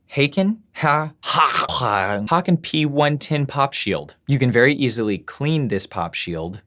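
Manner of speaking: normal talking voice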